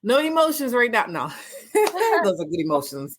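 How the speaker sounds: real laughter, fighting off tears; laughing through tears. Real, not acted. Host holding back tears after the guest's words, laughing. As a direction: laugh it off before crying. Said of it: "I'm about to get all teary-eyed".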